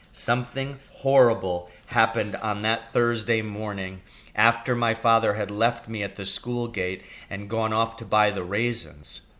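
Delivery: plain reading voice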